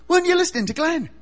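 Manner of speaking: high, loud and fast; indignant outburst. Acted. Joseph's mate demanding answers, indignant. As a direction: snap it out in mock indignation.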